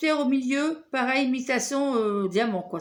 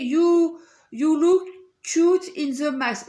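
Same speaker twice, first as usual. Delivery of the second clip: slow, louder English read in a heavy French accent; 5 dB louder, slower than usual. Real, not acted. Vlogger reads the English print on her pouch to viewers, in a French accent. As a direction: spell out the English words carefully in a French accent. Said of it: "Excusez-moi pour mon anglais si je le prononce très, très mal".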